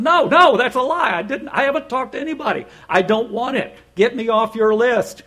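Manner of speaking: loud, high-pitched angry protest; indignant retort. Retold or imitated. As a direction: push back hard at a pushy telemarketer. Retold argument with pushy telemarketer.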